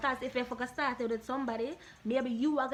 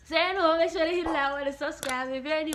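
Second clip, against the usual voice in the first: loud outro; 3 semitones higher, 7 dB louder than usual. Real, not acted. Outro call to subscribe.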